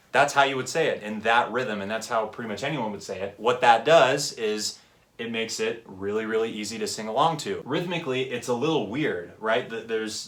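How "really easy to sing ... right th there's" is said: ordinary talk, nothing marked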